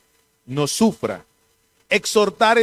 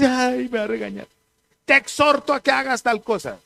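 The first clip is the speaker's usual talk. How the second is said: mock whine; 7 semitones higher than usual. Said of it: "y entonces".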